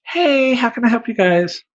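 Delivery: high, bright shop-clerk greeting; chirpy clerk voice. Retold or imitated. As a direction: squeak it like an eager pet-store girl. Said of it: "she's like".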